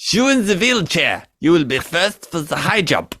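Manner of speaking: hammed-up German officer accent; mock German accent, played as a bad-taste joke. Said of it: "doing a German accent".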